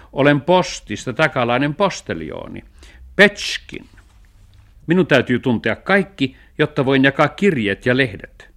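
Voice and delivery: lightly voiced postman, high and loud; officious postman. Postman tells strangers who he is, officious.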